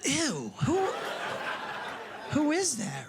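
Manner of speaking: high, disgusted "ew, who is that"; grossed-out wife. Retold or imitated. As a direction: recoil at the sleeping husband. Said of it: "and then you're like".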